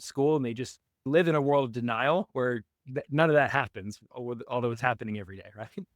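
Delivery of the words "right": normal talking voice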